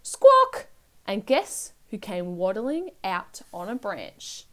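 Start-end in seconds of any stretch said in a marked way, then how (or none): 0.0-0.7 sudden loud, high bird squawk; baby's squawk. A narrator's reading. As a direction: squawk the word like a cockatoo. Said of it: "Then she started to squawk".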